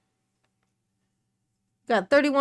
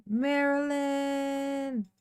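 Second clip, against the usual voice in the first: slow, softer drawn-out call; 5 dB quieter, much slower than usual. Pretend. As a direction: stretch the name out on a level note. Calls for absent viewer.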